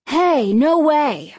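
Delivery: firm, insistent denial; insisting. A narrator's reading. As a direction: brush the claim off firmly. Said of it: "Danny insisted".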